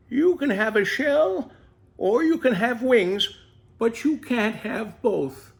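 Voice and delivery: wise mother tortoise. Acted. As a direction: voice the old mother gently passing on wisdom. Mother tortoise gives advice.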